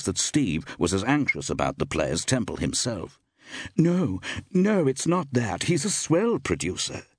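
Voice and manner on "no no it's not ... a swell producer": high-pitched, hurried-sounding protest; hasty denial. A narrator's reading. Actress defending the producer to her friend, hasty. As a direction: jump in to deny it, pitched up. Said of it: "replied Iris hastily".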